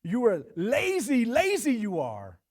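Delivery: "lazy" hammered loud and slow both times; emphatic. Retold or imitated. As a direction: lean hard on "lazy", slowly and loudly.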